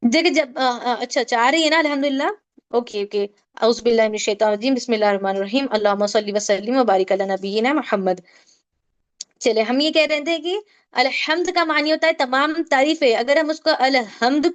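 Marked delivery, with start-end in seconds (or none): 3.6-8.2 low, quick Arabic recitation; devout recitation. Acted. Teacher opens the class with a low prayer recitation. Opening recitation before lesson.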